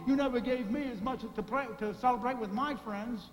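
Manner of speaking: hammed-up resentful whining; mock pouting. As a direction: sulk it out like a sulky child. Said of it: "He started to pout".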